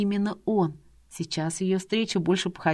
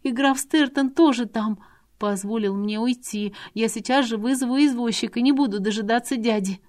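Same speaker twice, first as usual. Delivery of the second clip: put-on a scared girl begging to be let go; a young girl pleading with her mistress, high and whimpering; whimpering plea; 6 semitones higher than usual. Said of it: "Девушка снова захныкала".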